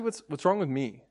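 subdued, halting question to himself; self-questioning. Retold or imitated. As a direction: turn the blame inward, deflated. Blame turned back on himself.

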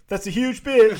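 high, loud mock shout; heckler's yell. Bystander finishing off the heckling of a tall woman, mock yell. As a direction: call it out like a rude bystander. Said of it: "people just yelling at her".

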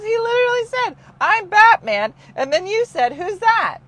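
high, loud mock exasperation; imitating the exasperated wife. Retold exasperated wife quote.

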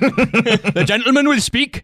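high, slow Geordie voice; a judge speaking Geordie; Geordie accent. Pretend. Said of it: "proceedings are conducted in Geordie".

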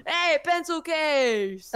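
high, slow, loud mock dad call; imitating the dad blasting the song. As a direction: call it out across the car like a proud dad. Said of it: "your dad just going".

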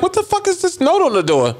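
shocked outburst. Retold or imitated. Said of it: "I pulled up like".

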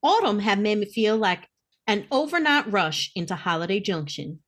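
ordinary talk, nothing marked

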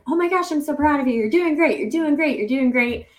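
high, fast excited cheering; bubbly cheer-on voice. Retold or imitated. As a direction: cheer like a proud fan, loud and quick. Cheering herself on in race.

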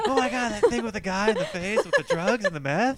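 loud, high, laughing mock outburst; mock excited gushing. Host parodying their future spoiler episode, loud laughing excitement. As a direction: gush spoilers in pretend amazement, laughing.

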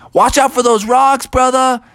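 high shouted warning. Acted. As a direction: yell a warning down the slope.

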